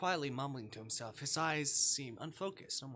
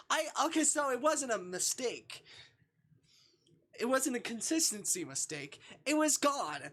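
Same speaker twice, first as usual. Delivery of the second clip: high, loud, worked-up realization; real excited high exclamation; 11 semitones higher, 6 dB louder than usual. Real, not acted. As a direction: it clicks and you get loud about it. Realizing talisman was really gone.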